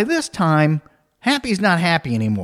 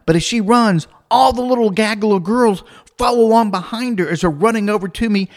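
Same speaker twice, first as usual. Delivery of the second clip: usual speaking voice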